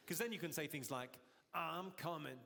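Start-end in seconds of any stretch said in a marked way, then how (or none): 1.5-2.4 seventies New York City accent; New York blaxploitation voice. Shown as a demonstration. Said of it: "nineteen seventies blaxploitation New York City voice".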